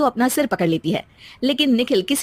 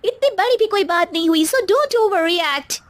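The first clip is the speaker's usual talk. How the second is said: put-on high, drawn-out bratty teen; spoilt daughter voice; 10 semitones higher, much slower than usual. Daughter brushing off her father, high and bratty. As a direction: sass your old-fashioned dad from up high. Mohini whining at mother.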